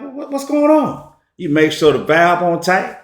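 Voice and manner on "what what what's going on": high, stammering what what what; high baffled stammer; confused surprise. Retold or imitated. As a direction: sputter like the grill won't light. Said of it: "You're like".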